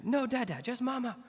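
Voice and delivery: put-on high little-kid voice pushing Dad away; toddler refusing. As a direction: imitate the toddler wanting only Mama. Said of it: "she would say".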